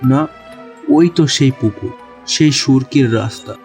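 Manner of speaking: plain reading voice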